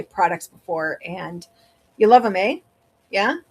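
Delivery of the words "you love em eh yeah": Wisconsin lilt, the eh rising at the end; Wisconsin accent. Real, not acted. Streamer catches her own Wisconsin accent talking to chat. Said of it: "I sounded so Wisconsin there".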